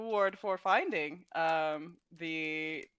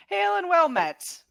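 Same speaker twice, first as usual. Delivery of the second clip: confident hail; 12 semitones higher, 7 dB louder than usual. Acted. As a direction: call out boldly, full of confidence. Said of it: "with all the confidence in the world".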